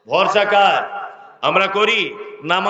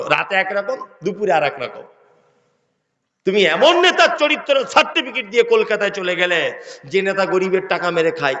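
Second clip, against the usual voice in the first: ordinary talk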